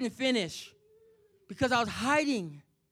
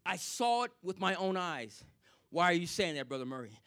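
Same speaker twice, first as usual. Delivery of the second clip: normal talking voice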